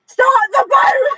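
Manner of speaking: high, loud, drawn-out shout to the crew; yelled order. Acted. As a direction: bellow it like a captain fleeing to the ship. Acted captain yelling while running back.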